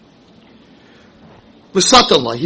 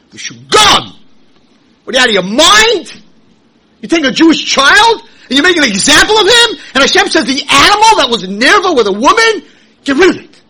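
loud, high indignant preaching; outraged preaching; 11 semitones higher, 10 dB louder than usual. Acted. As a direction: thunder at the crowd in outrage.

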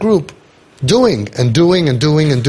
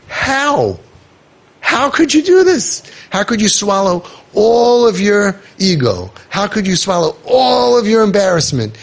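high-pitched, drawn-out disbelief, leaning on HOW and ALL; incredulous amazement; 6 semitones higher than usual. Retold or imitated. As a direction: act out astonishment, pitched way up and slow. Acts out amazement at the parents.

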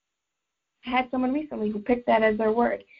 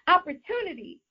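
bright upbeat lift on "Opportunity", said higher like good news; upbeat and happy; 6 semitones higher than usual. Retold or imitated. A client's anchor word for a boost, said upbeat and happy. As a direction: say "Opportunity" like a cheerful pep word. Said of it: "she's gonna say it in an upbeat, happy tone of voice".

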